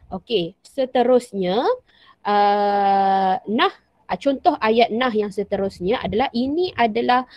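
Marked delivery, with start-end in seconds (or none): none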